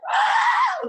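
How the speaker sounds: imitated high shriek of grandma; short, very high mock scream; freaked-out scream. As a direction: shriek high, acting out her reaction. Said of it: "she's not freaking out".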